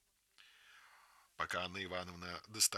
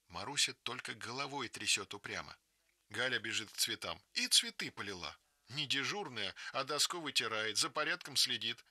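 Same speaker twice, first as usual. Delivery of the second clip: usual speaking voice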